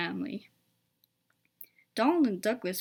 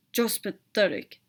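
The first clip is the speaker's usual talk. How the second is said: grunting. A narrator's reading. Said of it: "grunted Gordon".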